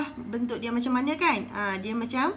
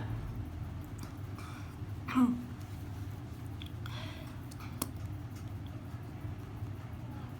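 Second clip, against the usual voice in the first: clears throat; 11 dB quieter than usual. Real, not acted. Teacher clears her throat before reading the example. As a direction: clear the throat to get ready.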